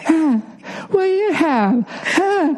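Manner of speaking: high, whiny mock voice; squeaky pestering mimicry; mocking impression of partner. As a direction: play her as a greedy little kid. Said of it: "I object to this impression".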